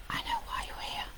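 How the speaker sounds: hushed whisper, letting the viewer in; conspiratorial whisper. Pretend. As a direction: whisper it like a secret.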